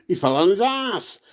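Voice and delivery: mock a broad Yorkshire accent. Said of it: "broad Yorkshire accent from [name]".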